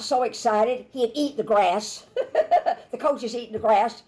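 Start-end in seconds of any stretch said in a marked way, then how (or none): 2.1-2.9 a quick high burst of laughter; real laugh. Real, not acted. Preacher telling the congregation about the grass-eating coach, laughing. 2.9-4.0 fast, laughing through the words. Real, not acted. Preacher amused by the coach who eats grass, laughing through it.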